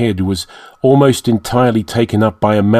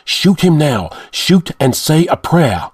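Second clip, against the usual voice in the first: urgent call; 6 semitones higher than usual. A narrator's reading. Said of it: "called to the man in the doorway".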